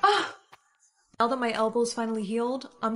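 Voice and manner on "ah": high sharp cry of pain; pained yelp. Acted. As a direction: yelp as if hurt. Reacting to hurt elbow.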